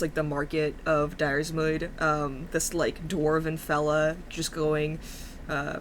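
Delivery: ordinary delivery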